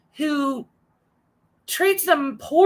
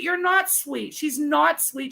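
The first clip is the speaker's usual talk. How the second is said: ordinary talk, nothing marked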